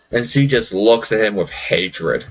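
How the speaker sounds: ordinary delivery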